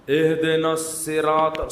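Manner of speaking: slow اہدنا الصراط with a plain ص; mock a botched recitation; imitating an imam; wrong recitation. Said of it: "سواد نہیں نکل رہے".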